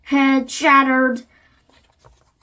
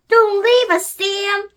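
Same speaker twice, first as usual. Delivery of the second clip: high, pleading call; crying out; 8 semitones higher than usual. A narrator's reading. Lucy begging her friend not to leave, high cry. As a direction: plead in a much higher voice. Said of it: "Lucy cried".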